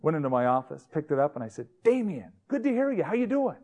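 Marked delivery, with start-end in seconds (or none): 1.8-3.6 high-pitched, cheery hello; breezy greeting. Retold or imitated. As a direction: greet him like an old pal, pitched way up. Mock-friendly greeting to threatening caller.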